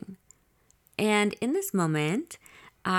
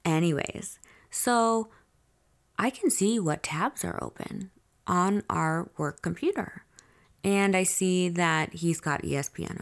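ordinary talk, nothing marked

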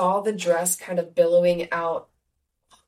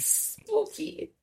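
high, soft, mock spooky; 11 semitones higher, 8 dB quieter than usual. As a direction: breathe it like a ghost story. Reacting to ghost story.

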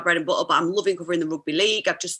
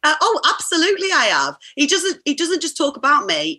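ordinary talk

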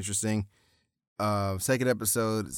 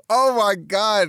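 laughing; 10 semitones higher, 8 dB louder, slower than usual. Real, not acted. Reacting to rapper character name.

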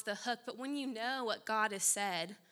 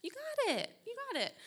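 much higher, chirpy eager agreement; mimicking eager yes; 13 semitones higher than usual. Answering her dad's request, high and eager. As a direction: pop up high, keen to help. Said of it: "I said".

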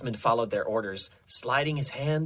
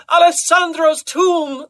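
very high, loud, drawn-out shout; ecstatic cry; 12 dB louder, slower than usual. A narrator's reading. Carmina at her climax revealing the secret, crying out. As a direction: wail it slowly at full pitch. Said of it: "as she cried".

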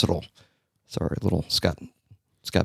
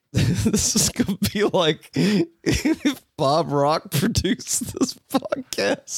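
high, squeezed out through laughter; can barely get the line out for laughing; 9 semitones higher, much slower than usual. Real, not acted. Host cracking up at own album joke, laughing.

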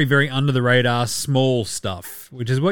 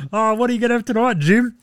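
quick, higher teasing voice; playful put-on voice; mock other voice; 9 semitones higher than usual. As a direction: toss the question up high and fast. Mock voice asking about dinner.

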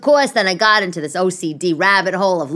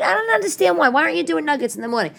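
ordinary talk

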